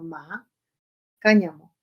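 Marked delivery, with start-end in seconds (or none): 0.0-0.5 soft, short rising MA; second tone. Shown as a demonstration. Teacher giving word for hemp, soft rising "ma". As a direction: lift "ma" like a brief question. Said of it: "en segundo tono".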